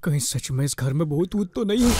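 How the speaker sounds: low, nervous murmur to oneself; hushed, uneasy self-talk; fearful hushed question. Acted. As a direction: wonder under your breath, scared of ghosts. Scared alone in haunted house.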